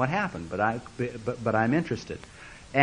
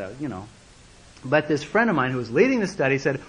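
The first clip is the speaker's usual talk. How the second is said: normal talking voice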